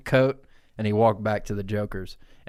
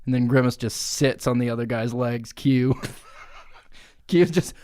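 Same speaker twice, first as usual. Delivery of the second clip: ordinary talk, nothing marked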